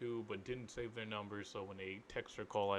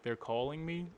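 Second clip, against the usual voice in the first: ordinary talk, nothing marked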